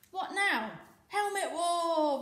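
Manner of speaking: high, loud, laughing war cry, slow; excited battle cry. A narrator's reading. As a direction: shout it gleefully, drawn out. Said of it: "Volga cried".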